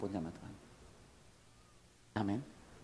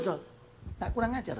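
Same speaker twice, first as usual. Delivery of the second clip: normal talking voice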